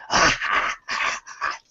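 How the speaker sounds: breathy creepy cackle; skull cackle. Acted. GM's skull gloats at the party, eerie cackle. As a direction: chuckle like a gloating undead.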